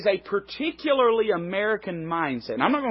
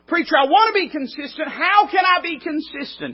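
a higher, louder voice of an eager churchgoer asking the preacher; pleading congregant; 10 semitones higher, 4 dB louder than usual. Retold or imitated. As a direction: play the earnest listener begging for an answer.

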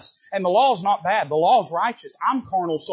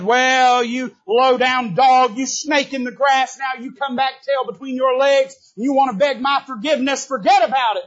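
scornful rebuke; 7 semitones higher, 7 dB louder than usual. Acted. David scorns the returning Shimei, loud and contemptuous. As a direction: spit it at the traitor. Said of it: "David could have said".

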